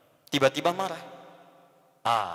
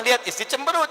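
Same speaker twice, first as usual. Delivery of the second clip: usual speaking voice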